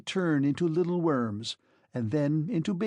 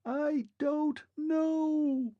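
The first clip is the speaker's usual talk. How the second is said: thin distant voice; 10 semitones higher than usual. A narrator's reading. Vanishing Clockwork Man to Arthur, thin and far off. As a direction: call back from a great distance. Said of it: "like someone shouting in the distance".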